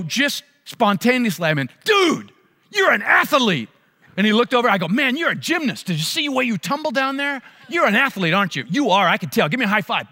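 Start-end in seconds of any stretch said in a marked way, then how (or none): 1.8-10.1 encouraging cheer. Retold or imitated. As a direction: pump the boy up like a coach. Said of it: "I said something that was encouraging".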